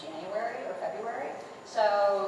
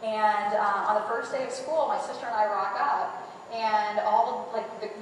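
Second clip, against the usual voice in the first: ordinary delivery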